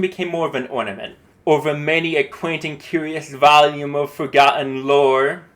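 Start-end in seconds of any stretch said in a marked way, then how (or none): 1.5-5.5 poem reading. Shown as a demonstration. Reads poem line.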